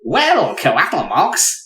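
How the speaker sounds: high, swooping eager inventor voice; Wallace. Acted. Inventor challenging Coatl Mox to arm wrestling, hammy Wallace voice. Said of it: "we can't do this voice. It's terrible".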